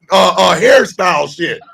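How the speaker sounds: usual speaking voice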